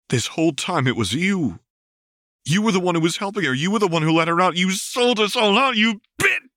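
Vi's accusation escalating into a shout; furious accusation. Acted. Vi confronts the traitor Lux.

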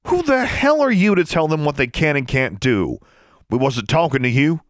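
high, indignant challenge; angry confrontation. A narrator's reading. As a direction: throw it back at them indignantly. Heated quarrel, confronting the brothers.

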